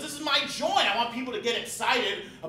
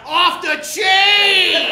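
drawn-out, much louder and higher shout; catchphrase shout; 8 semitones higher, 11 dB louder, much slower than usual. Acted. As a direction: stretch the catchphrase out at full volume. Host's catchphrase yelled.

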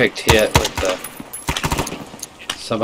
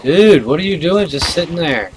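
frustrated yell; 6 semitones higher, 4 dB louder than usual. Real, not acted. Yelling at idle teammate.

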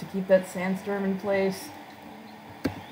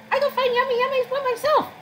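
very high, squeaky monotone creature voice; food-loving creature; 4 dB louder than usual. Acted. Hungry creature off to find food, high squeaky voice. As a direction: squeal it out like an eager little creature.

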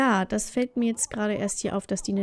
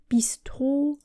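breathy unvoiced whisper of the word Bistro; 3 semitones higher than usual. Gamer whispering the Sims destination to herself. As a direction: whisper the name to yourself.

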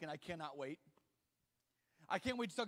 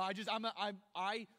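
normal talking voice

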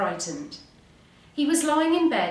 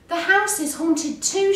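high, loud cry of alarm; alarmed shout; 10 semitones higher, 6 dB louder than usual. A narrator's reading. Frog warns Duck the house is haunted, alarmed shout. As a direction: shout it out in a storybook panic. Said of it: "shouted Frog".

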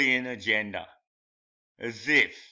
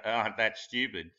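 normal talking voice

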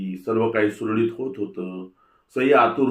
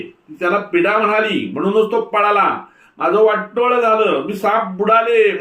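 much higher, louder wailing tirade; ranting lament; 9 semitones higher, 5 dB louder than usual. A narrator's reading. Mother-in-law ranting over her ruined home, high and loud. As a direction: rant on and on at her ruin. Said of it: "सासू कितीतरी वेळ तशीच बडबडत होती".

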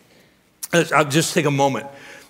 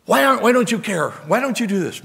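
high-pitched, exasperated mock plea; impatient prayer; 7 semitones higher than usual. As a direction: badger God like a fed-up complainer. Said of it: "but impatient with prayer".

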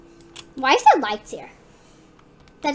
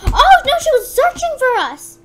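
high, loud cry of alarm; aghast gamer squeal; shocked panic; 9 semitones higher, 7 dB louder than usual. Real, not acted. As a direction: blurt out the awful realisation. Reacting to monster reveal.

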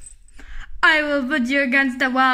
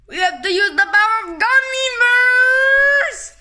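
loud, high rallying call; rally the troops, loud and high; heroic shout; 8 semitones higher, 4 dB louder than usual. Acted.

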